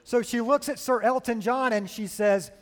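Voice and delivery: normal talking voice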